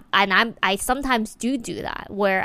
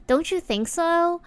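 "Don't you think so?" pitched much higher, lifting at the end; high rising question; 8 semitones higher than usual. Shown as a demonstration. Demonstrating an unsure woman seeking confirmation, much higher rise.

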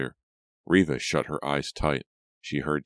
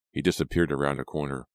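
usual speaking voice

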